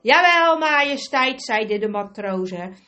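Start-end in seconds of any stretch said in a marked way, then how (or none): 0.0-1.4 loud, high hearty reply; sailors' answer. Acted. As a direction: answer the king smartly as one crew.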